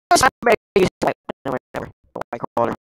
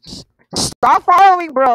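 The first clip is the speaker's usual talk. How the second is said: annoyed shout; 8 semitones higher, 6 dB louder than usual. Real, not acted. Chased by a car.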